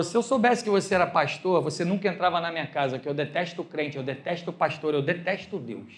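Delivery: low, soft, deliberate rejection; quiet contempt, pitch dropped; retold cold hostility. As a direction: imitate a host coldly stating his hatred. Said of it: "falou desse jeito".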